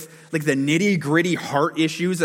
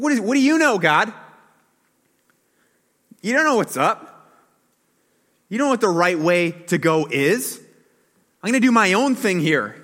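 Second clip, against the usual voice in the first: loud, higher mocking challenge to God; defiant dare; 4 semitones higher, 4 dB louder than usual. The people daring God, loud and defiant. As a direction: shake your fist at God with your voice. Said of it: "It's like they're daring him".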